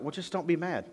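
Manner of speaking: high, breezy brush-off; dismissive friend. Retold or imitated. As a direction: wave the problem away. Mocking an unhelpful friend.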